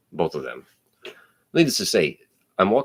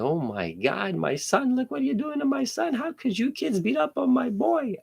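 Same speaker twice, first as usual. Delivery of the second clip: high-pitched, frantic mimicry of a shrill mother; a mocking, much higher mother's wail; mock yelling mother. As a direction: impersonate the hysterical mom, pitched way up. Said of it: "starts yelling at me".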